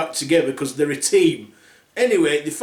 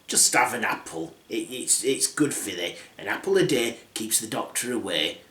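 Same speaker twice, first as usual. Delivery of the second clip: put-on old hag; 5 semitones lower, 6 dB quieter than usual. Old queen pushing apple.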